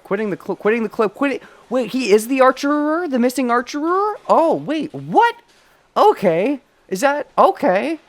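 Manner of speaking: high, loud stunned disbelief; shocked realization. Real, not acted. Streamer realizing the classmate is the archer, shocked. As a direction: gasp out the twist you never saw coming.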